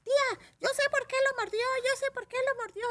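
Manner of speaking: put-on very high small-child voice; squeaky, insistent kid impression; little nephew. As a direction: imitate an excited little boy telling on someone. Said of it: "viene un sobrinito mío".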